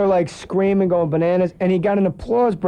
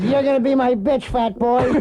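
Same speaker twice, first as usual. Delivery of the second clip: higher-pitched nasty puppet; George the puppet; 6 semitones higher than usual. Retold or imitated. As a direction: threaten like a foul-mouthed dummy. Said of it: "It's the nastiest".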